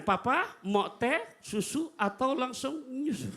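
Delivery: high, slow, coy offer to papa; wife offering teasingly. Retold or imitated. Wife teasing husband before work, coy and high. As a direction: sweetly tease the husband with drinks. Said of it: "ibu yang menawarkan".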